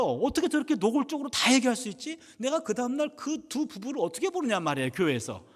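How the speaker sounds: high, loud, worked-up venting; exasperated outburst. Retold or imitated. Pastor overwhelmed by a couple's secrets, exasperated. Pastor re-enacts his shock at confession.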